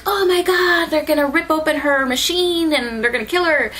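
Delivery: high exclamation easing down, acted panic; alarmed. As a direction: react in mock horror, starting high. Retold panic seeing friend attacked.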